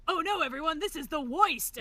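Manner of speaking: cartoon Brooklyn, hitting only certain syllables; hammed-up unconvincing Brooklyn accent; vague Brooklyn accent. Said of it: "It's a Bugs Bunny accent".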